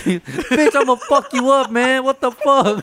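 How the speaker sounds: high, loud mock outrage; imitated startled threat. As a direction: play the spooked dad cursing his son. Retelling startled dad's angry threat.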